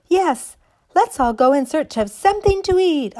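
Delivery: high, loud chorus of dolls; excited dolls crying out. Acted. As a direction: cry out eagerly like toys. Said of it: "all the other dollies cried".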